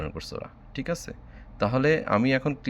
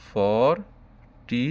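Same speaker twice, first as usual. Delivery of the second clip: Forty stretched very slowly; drawn out; much slower than usual. Shown as a demonstration. Teacher writing the number for pupils, forty long drawn out. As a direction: drag out both syllables of forty. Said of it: "টান দিয়ে টু লিখতাম".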